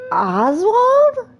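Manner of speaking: rising squeal of disbelieving recognition; astonished. Real, not acted. Player suddenly recognizes Oswald, astonished. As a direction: pitch climbing very high, incredulous. Surprised at seeing the character.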